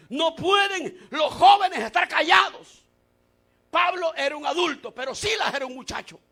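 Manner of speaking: loud, high, declamatory preacher shout; full-force preaching. Real, not acted. Preacher proclaims that God casts out every curse, full-force preaching. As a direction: proclaim it forcefully to the whole church.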